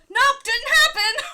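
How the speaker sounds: high, loud, slow shout through laughter; laughing shout. Retold or imitated. Opponent after a failed draw, a loud laughing shout. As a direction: shout the defeat while laughing.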